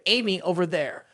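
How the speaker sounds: ordinary delivery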